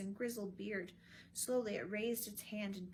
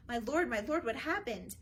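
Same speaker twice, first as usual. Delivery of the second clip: louder, higher urgent call; anxious calling; 3 semitones higher, 8 dB louder than usual. A narrator's reading. Friends rushing up to the prince, calling loudly. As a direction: call out to him in alarm.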